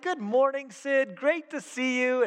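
high, drawn-out cheery greeting; Mister Happy Guy. Retold or imitated. Refreshed pastor greeting his friend after a shower, exaggeratedly happy. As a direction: imitate an over-happy morning greeter. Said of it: "he is just Mister Happy Guy".

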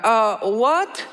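put-on slow, high, puzzled German accent; hesitant German-accented rookie, mock confused. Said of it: "I have to do the accent".

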